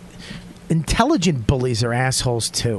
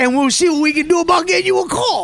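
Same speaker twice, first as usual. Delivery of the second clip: recruiter voice; 11 semitones higher, 3 dB louder than usual. Acted. Joins the recruiter bit.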